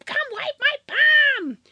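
put-on very high-pitched child's yell for mom; screaming little daughter. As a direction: imitate a small child shrieking across the house. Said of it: "my daughter is screaming".

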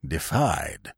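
low, soft "defied" after a pause; overwrought old man. A narrator's reading. Old uncle complaining to Wooster, low and overwrought. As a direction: let the word sink out, quietly overwrought. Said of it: "in a rather overwrought way".

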